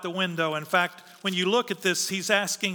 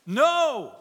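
a sharp higher louder No; emphatic no; 5 semitones higher, 3 dB louder than usual. Acted. Preacher rejecting sinning under grace with an emphatic No. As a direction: slam the door on the question. Preacher answers the rhetorical question.

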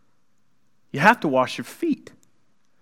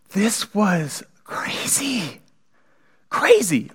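hushed emphasis; 2 semitones higher, 5 dB quieter, much slower than usual. Real, not acted. Stressing how shocking it was.

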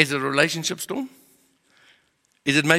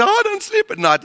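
whiny complaint; 5 dB louder than usual. Retold or imitated. Quoting people who can't sleep.

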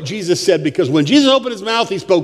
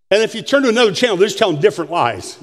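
ordinary talk

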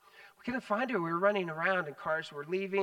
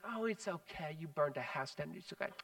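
soft, hushed forgiving coo; mock-gentle parent voice, much softer; cooing to a child; 10 dB quieter than usual. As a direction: soothe a toddler who did wrong. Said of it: "and you're like".

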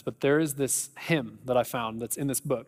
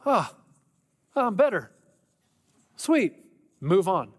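high, louder breezy sigh of being fixed; mock instant relief; 5 semitones higher, 4 dB louder, slower than usual. Someone who just cried out to God, mock relieved. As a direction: toss it off as if instantly cured.

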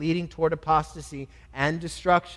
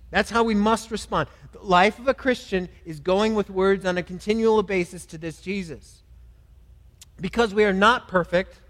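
preaching at full force; 3 semitones higher, 4 dB louder than usual. Acted. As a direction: press the call home like a fervent preacher. Preacher pleads for confession.

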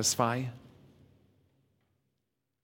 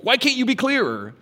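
whiny retort; 12 semitones higher, 5 dB louder, faster than usual. Retold or imitated. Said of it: "To which we're like".

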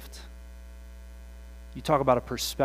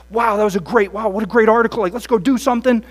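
high, loud, fast hype; gushing excitement; 6 semitones higher, 11 dB louder, faster than usual. Retold or imitated. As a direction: bubble over with eagerness.

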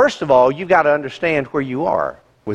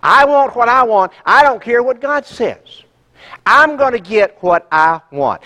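loud, high, defiant selfish voice; mock defiant child demanding its way; selfish attitude; 5 semitones higher, 4 dB louder than usual. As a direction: play a stubborn "me first" heart. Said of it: "this attitude says".

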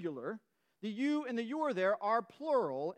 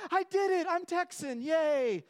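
much higher and louder, gleeful; hammed-up triumphant cheer; mock celebration; 8 semitones higher, 5 dB louder than usual. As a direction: squeal it like a proud new Texan. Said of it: "and you're like".

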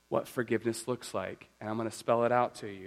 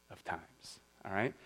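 ordinary talk

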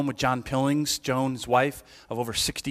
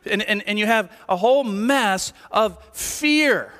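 raised, driving sermon voice; fervent preaching; 9 semitones higher, 4 dB louder than usual. Acted. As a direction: preach it out to the pews with force. Preaching to congregation.

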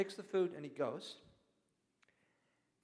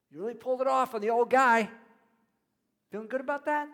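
louder and higher, needling the deceivers; mocking taunt; 6 semitones higher, 12 dB louder than usual. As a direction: rub it in, half teasing. Preacher taunting Jacob and Rebecca.

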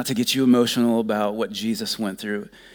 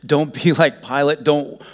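louder, firm warning; loud pleading command; forceful warning; 5 semitones higher, 8 dB louder than usual. Real, not acted. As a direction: drive the warning home loudly.

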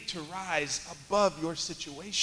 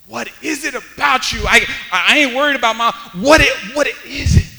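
loud, urgent questioning; full-force preaching; 6 semitones higher, 15 dB louder than usual. Acted. Preacher voicing amazed onlookers, full-force preaching. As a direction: stumble forward with rising force.

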